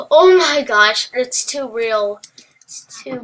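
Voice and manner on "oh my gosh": loud, raised cry of exasperation; exasperated outburst. Real, not acted. Gamer fed up with lag, exasperated outburst. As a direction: burst out in frustration.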